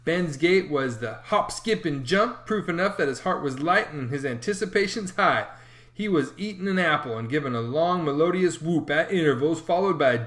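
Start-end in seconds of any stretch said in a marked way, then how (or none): none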